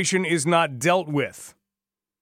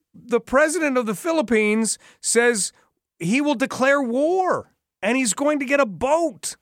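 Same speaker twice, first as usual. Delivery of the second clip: pitched higher in disbelief; can you believe this, rising higher; incredulous disbelief; 7 semitones higher than usual. Real, not acted. Host exasperated at absurd news.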